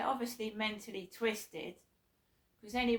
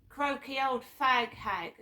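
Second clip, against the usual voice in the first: quoting the insult; 4 semitones higher, 6 dB louder than usual. Retold or imitated. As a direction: spell out the slur with disgust. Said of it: "I will tell you what they said".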